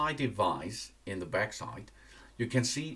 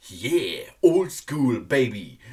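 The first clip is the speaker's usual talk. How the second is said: excited; 7 dB louder, slower than usual. Real, not acted. Reviewer hyping the FM radio mode, loud and playful. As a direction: cheer it like a proud radio fan.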